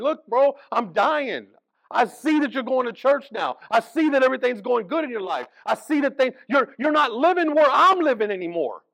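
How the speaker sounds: loud, high begging to a churchgoer; a suffering man pleading with a churchgoer, loud and high; desperate pleading. Retold or imitated. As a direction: plead with a friend who moved on. Blind man voiced pleading.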